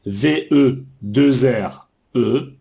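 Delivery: letters "V E deux R E" spelled slowly; slow dictation spelling. Shown as a demonstration. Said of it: "Nous avons aussi vers".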